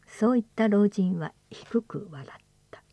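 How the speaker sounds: ordinary delivery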